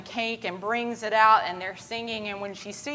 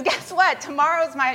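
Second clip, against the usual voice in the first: high-pitched, eager news; excited announcement; 7 semitones higher, 5 dB louder than usual. Retold or imitated. Girl tells her friend about her birthday, excited. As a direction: pipe up brightly with big news. Girl's announcement, acted once more.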